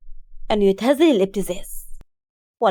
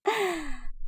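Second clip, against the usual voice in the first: soft, high chuckle; mother's laugh; 7 semitones higher, 9 dB quieter than usual. A narrator's reading. Mother amused by her daughter, laughing. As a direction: laugh indulgently and quietly. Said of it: "ضحكت الام توحيدة".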